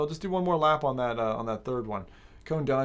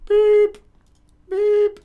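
high squeaky mouth beep; loud falsetto beep noise; imitated beep; 12 dB louder than usual. Mimicking a buzzer sound.

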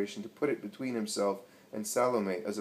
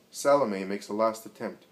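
plain reading voice

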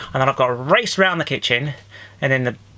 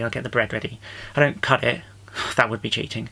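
usual speaking voice

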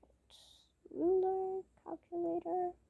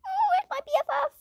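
put-on much higher, fast, squeaky little girl; high kid voice; much faster than usual. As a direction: chirp it like a small girl looking for her friend.

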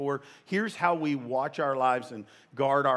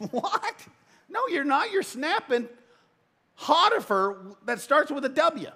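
mock disbelief; 12 semitones higher than usual. Preacher mock-confronting a churchgoer.

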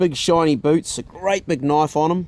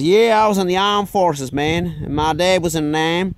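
Texan brag; 3 semitones higher than usual. Retold or imitated. Imitating Chuck.

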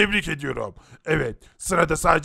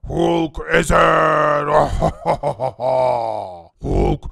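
Hulk rage voice; 3 semitones lower, slower than usual. Acted. Transforming into Hulk in class, monstrous roaring shout. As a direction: turn into a raging monster. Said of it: "Sen Hulk'a dönüşünce sesin aynı bana benziyor".

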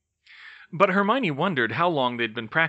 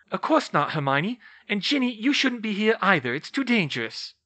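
higher-pitched, firm motherly telling-off; pitched-up motherly reproof; worried scolding mother; 9 semitones higher, 4 dB louder than usual. A narrator's reading. Narrator voices Molly.

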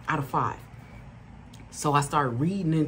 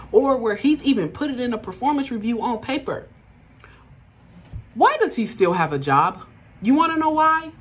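raised higher and louder, outraged and rising; indignant build-up; 6 semitones higher, 4 dB louder than usual. Real, not acted. Calling out the manager before the audience, rising indignation. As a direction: let the outrage climb to a peak.

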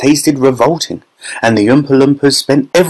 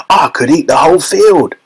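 delighted grandpa, pitched up high; grinning delight; 6 semitones higher than usual. A narrator's reading. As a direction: say it through a happy grin. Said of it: "grinning with delight".

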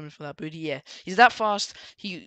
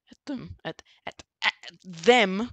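voice failing; 3 dB louder, slower than usual. Real, not acted. Storyteller repeating words as the voice fails, voice failing. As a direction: voice giving out mid-phrase. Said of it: "Jesus, my voice".

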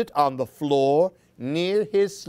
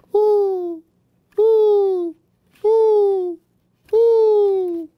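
high, loud hooting owl; 7 dB louder, much slower than usual. Acted. Owl hooting at Baby Chick in the dark. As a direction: hoot long and high like an owl.

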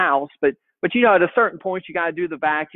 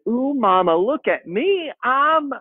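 put-on higher little girl showing off; toddler voice; 6 semitones higher than usual. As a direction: beam at Mama like a proud toddler. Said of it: "She said".

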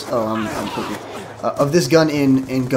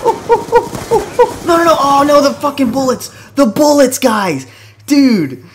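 high, loud exasperated yelps; loud frantic oh-no yelling; frustrated yelling; 13 semitones higher, 8 dB louder, slower than usual. Real, not acted. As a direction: yell in real frustration at dying. Gets killed by bullets, yelling.

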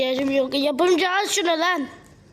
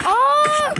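sudden high scream of fright; sharp high startled shriek; shocked scream; 11 semitones higher, 3 dB louder than usual. Real, not acted. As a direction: scream as you slip. Falls while climbing.

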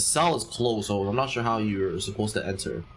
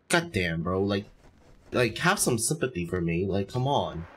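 normal talking voice

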